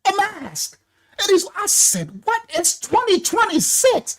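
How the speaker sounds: incredulous. Retold or imitated. Incredulous at masked man.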